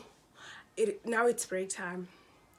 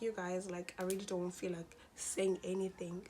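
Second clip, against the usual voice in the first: whisper; 6 dB quieter than usual. Retold or imitated. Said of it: "I was like".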